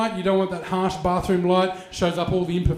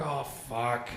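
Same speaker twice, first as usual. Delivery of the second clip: much softer, low, sinking; dismayed mutter; 7 semitones lower, 12 dB quieter than usual. Retold or imitated. Comic realising his mistake, low dismayed mutter. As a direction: let it drop under your breath. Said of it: "I was like".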